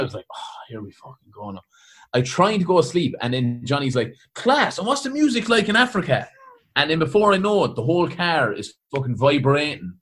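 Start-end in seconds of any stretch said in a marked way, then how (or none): 0.3-1.6 very quiet, low weary aside; hungover dread. Retold or imitated. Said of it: "when I'm hungover, I don't wanna speak". 4.3-6.3 high-pitched, gushing curiosity; enthusiastic. Retold or imitated. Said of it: "so enthusiastic".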